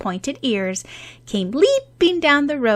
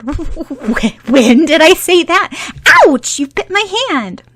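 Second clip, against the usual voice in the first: flustered stammer; 10 semitones higher, 10 dB louder than usual. Acted. Caught, stammering, bitten.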